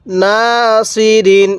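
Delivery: নাসিরিন read very slowly, syllable by syllable; slow recitation. Shown as a demonstration. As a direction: draw out নাসিরিন with one alif on না. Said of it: "এরকমভাবে শিখব".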